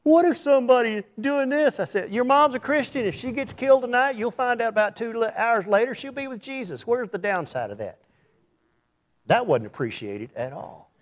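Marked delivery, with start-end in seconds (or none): 0.0-8.0 mock preacher reply. Retold argument, acted reply.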